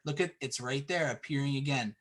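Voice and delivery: ordinary talk, nothing marked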